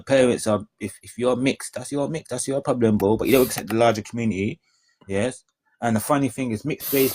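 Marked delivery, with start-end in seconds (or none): none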